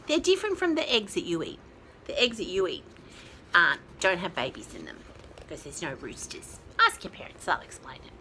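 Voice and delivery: ordinary delivery, nothing marked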